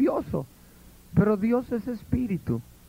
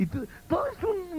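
ordinary delivery

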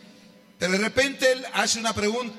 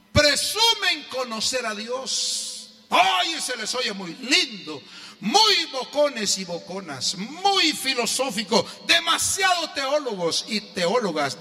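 preaching; 5 semitones higher, slower than usual. Acted. Preaching at full force.

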